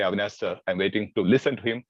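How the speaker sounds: ordinary talk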